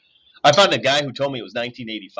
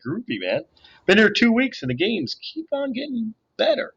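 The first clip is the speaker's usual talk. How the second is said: a hint of a groovy seventies kid, higher; laid-back hippie gamer; 11 semitones higher than usual. A narrator's reading. Darren telling the new kid the games keep getting better, in a mellow hippie voice.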